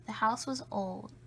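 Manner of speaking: ordinary delivery, nothing marked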